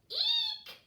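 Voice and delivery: very high loud shriek; a tiny penguin squealing in fright. Acted. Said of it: "said Peaky".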